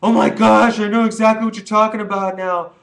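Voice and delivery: gushing, high and loud; gushing fake repentance, hammed-up; mock converted weed fan. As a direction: ham up a sudden convert. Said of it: "you'd be like".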